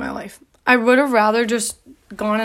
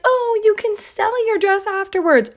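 mocking quote; 9 semitones higher than usual. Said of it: "They're always like".

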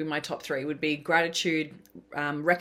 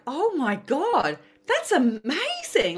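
much higher, louder and slow, drawn out in delight; overjoyed; 10 semitones higher, 6 dB louder, much slower than usual. Retold or imitated. Hearing friend won lottery, slow amazed joy. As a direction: stretch every word in amazement. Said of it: "And I was like".

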